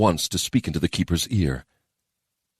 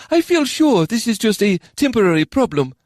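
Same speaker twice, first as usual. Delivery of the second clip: calm official's reassurance; smooth it over for the council; reassuring official; 5 dB louder than usual. Acted.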